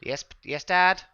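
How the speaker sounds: put-on high, loud little boy calling back. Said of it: "a little boy climbs out".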